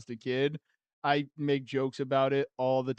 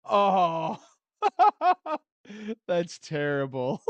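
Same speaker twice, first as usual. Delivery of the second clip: loud "oh" breaking into laughter; real helpless laugh at something awful; laughing in dismay; 7 semitones higher, 4 dB louder, much slower than usual. Real, not acted. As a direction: burst out laughing, then drag the words out. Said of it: "Sorry to laugh".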